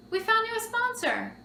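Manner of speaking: high-pitched, thrilled announcement; excited caller. Acted. Organizer phones the contestant with sponsor news, excited. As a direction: play the caller bursting with good news.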